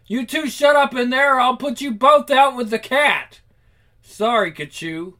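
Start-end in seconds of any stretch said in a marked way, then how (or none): 0.1-3.4 loud high yell through the wall; raised angry shout. Acted. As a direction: bark at the noisy roommates. Kachoo yells through wall.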